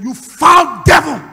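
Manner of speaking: loud, slow, high rebuke of the devil; preacher reliving the deliverance, rebuking the devil loudly; rebuking shout. Retold or imitated. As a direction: thunder at the demon with authority. Casting out a demon.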